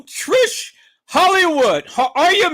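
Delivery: high, loud, stretched-out welcome; exuberant greeting. Real, not acted. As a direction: holler a big hello to a favourite guest. Calling out greeting to viewer.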